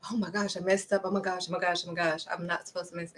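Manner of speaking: hushed, fast panicked fretting; freaking out. Retold or imitated. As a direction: mutter the panic under your breath, quick. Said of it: "'Cause I was freaking out".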